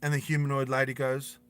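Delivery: ordinary delivery, nothing marked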